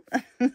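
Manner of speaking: short high laugh at her own slip; embarrassed laugh. Real, not acted. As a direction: laugh off the stumble. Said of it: "c'est difficile à dire". (petit rire gêné après l'erreur)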